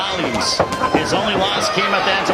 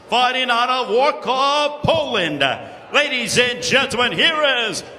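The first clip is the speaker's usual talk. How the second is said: ring announcer; 5 semitones higher than usual. Acted. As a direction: stretch the name for the crowd. Ring announcer introduces fighter.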